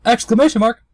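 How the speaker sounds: sudden high-pitched exclamation; mock alarm. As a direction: shout it like a startled reaction. Reading symbols aloud between question marks.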